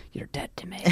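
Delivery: hushed, breathy mock threat; whispered playful threat; stage whisper. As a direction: whisper it like a joking menace. Mock threat to non-Ranger fans.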